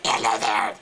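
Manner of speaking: scratchy phlegmy throat voice on "hello"; itchy, gargled voice-box rasp; Hakalugi throat noise. Shown as a demonstration. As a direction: rasp it from the back of the throat, like hawking. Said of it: "I call it the Hakalugi noise".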